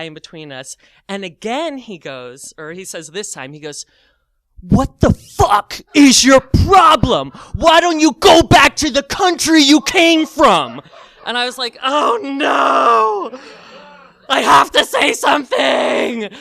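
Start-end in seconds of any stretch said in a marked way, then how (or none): 4.6-10.8 slow, high, loud angry outburst; hostile mock shout. Hostile customer to a foreign shopper, imitated furious shout. 11.8-16.3 loud, high mock wail; hammed-up dismay. Teller to himself on deciding to step in, mock-anguished cry. Teller's inner alarm, played for comedy.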